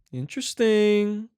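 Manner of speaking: long, high, drawn-out suspicion; mock intrigue. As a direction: stretch the word out as if onto a conspiracy.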